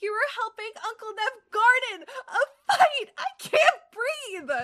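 very high, loud shriek of hilarity; giggly squeal. Acted. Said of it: "squeaked and collapsed into a fit of giggles".

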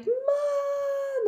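high shout for mom; calling Mom. Retold or imitated. Upset child shouts for her mom from the kitchen door. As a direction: yell for mom from the doorway.

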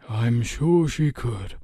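slow, weary elder; sighing old man. A narrator's reading. Old man conceding his mare is tired, sighing. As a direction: say it slowly, like a sigh. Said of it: "Emerus sighed".

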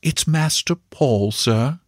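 lightly hushed-reverent servant's tone; secret, religious voice. A narrator's reading. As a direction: confide it like a holy secret. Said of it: "in a secret religious voice".

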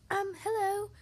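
high, timid little voice; tiny voice. Acted. Mister Tickle greeting a huge dragon, tiny high voice. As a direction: pipe it up small and high. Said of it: "in a tiny voice".

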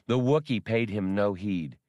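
ordinary delivery

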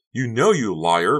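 high, loud, drawn-out accusation; angry shriek. A narrator's reading. Becca calling Honey a liar, angry shriek. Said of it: "Becca shrieked".

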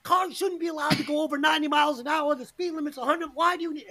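put-on high, hurried old-guy complaint; ranting old guy. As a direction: rattle off grievances at other drivers. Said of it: "I'm the old guy".

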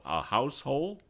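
"house hole" with a questioning rise; unsure pronunciation. Real, not acted.

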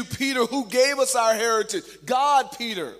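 loud, high-pitched rebuke of a friend; forceful admonishing. Acted. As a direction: press him hard like an indignant critic. Acting out critics confronting Peter.

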